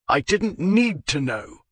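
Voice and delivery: a clipped, irritated snap, slowed; snapped. Acted. Marchmont snapping back at Zoe in a mild, irritated snap. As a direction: bite the words off in irritation. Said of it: "he snapped".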